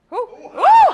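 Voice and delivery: high, loud delighted ooh; a sudden high squeal of surprise; surprised ooh. Real, not acted. Reacting to the same answer.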